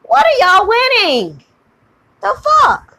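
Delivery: loud, very high scornful disbelief; incredulous outrage. Real, not acted. Demanding what her critics are winning, loud incredulous outrage. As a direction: shriek the question at them. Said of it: "I am heated".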